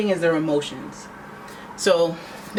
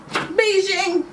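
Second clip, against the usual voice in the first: excited call; 13 semitones higher than usual. Real, not acted. Shouting the cat's meow location.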